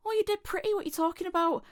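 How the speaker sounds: high-pitched retold compliment; disbelieving praise. Friend says she's pretty, high and disbelieving.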